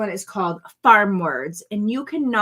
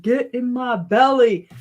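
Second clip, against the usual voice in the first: slow, louder put-on movie villain voice; movie character impression; 3 semitones higher, 6 dB louder, slower than usual. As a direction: ham up the Austin Powers line, slowly. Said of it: "that's not how he says it".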